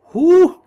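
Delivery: high, loud hooting "Who?"; owl hoot. Acted. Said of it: "It sounded more like an owl than a coyote".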